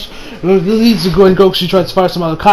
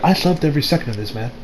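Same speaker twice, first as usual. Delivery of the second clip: usual speaking voice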